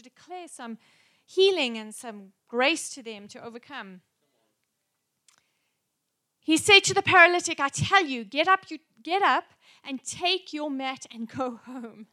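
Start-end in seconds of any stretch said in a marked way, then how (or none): 6.4-12.1 preaching at full force. Acted. Preaching Jesus' command.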